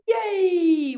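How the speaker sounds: high, drawn-out cheer. Shown as a demonstration. Class celebrates the mopping laughter, high cheer. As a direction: hold a long high yay. Group cheer after the chant.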